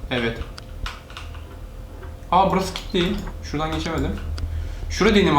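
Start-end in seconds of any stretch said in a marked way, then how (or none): none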